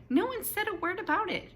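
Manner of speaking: usual speaking voice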